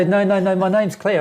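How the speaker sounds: laughing mock protest; mock insistent correction. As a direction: protest playfully that the name is Claire. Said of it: "And you go".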